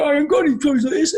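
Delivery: high-pitched, quick little-boy imitation; Zach. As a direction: put on your small son's high voice, rattled off. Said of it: "That's how you talk".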